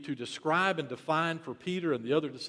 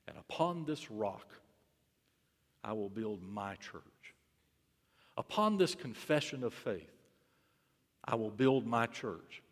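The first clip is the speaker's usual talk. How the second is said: hushed promise; 5 semitones lower, 8 dB quieter than usual. Acted. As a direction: speak the promise gently and deliberately.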